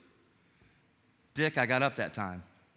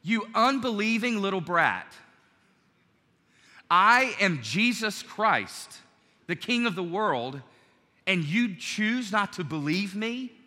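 louder, higher, slow scornful scolding; scolding rebuke; 5 semitones higher, 4 dB louder, slower than usual. Pretend. Jesus imagined scolding doubting Thomas, scornful and loud. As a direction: lay into him like a furious master. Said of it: "Jesus could have said".

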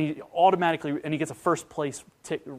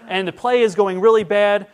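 high, loud exasperated complaint; loud mock despair; exasperated kid; 6 semitones higher, 12 dB louder than usual. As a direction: whine it out, much louder and higher, like a fed-up kid director. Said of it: "he's like".

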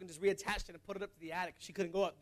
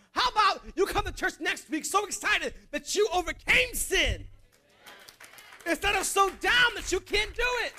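loud, high-pitched exhortation; full-force preaching; 5 semitones higher, 5 dB louder than usual. Real, not acted. Preacher urging the church to live holy, fervent and loud. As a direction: drive it home from the pulpit.

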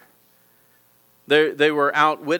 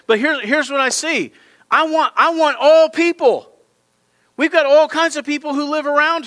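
raised, high-pitched preacher's call; fervent preaching; 8 semitones higher, 5 dB louder than usual. Acted. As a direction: preach it out to the whole room. Preaching at full force.

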